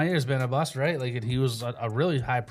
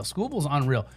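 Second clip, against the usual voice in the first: ordinary delivery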